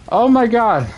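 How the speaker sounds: high startled oh my god; shocked. Real, not acted. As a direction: jolt of real surprise. Sudden shock in game.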